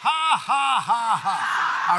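big, stepping-down "ha, ha, ha, ha"; deliberate laugh. Shown as a demonstration. Preacher showing you got to laugh, deliberate laugh. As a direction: proclaim the laugh in separate beats. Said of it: "you got to laugh".

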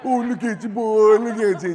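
put-on high cooing baby talk. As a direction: coo at the baby nephew, voice lifted high. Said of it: "all of a sudden".

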